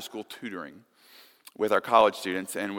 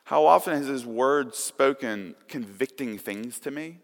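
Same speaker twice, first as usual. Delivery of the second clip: ordinary talk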